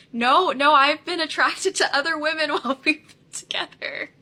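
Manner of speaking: loud, high imitation, laughing through it; confidently insistent quote, breaking into laughter; mock confident. Boyfriend answering her question, imitated confidence with laughter. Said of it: "very confidently".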